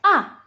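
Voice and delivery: "A" falling sharply, as if angry; short "A" with a hard downward stroke; fourth tone. Shown as a demonstration. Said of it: "Jadi seperti orang marah".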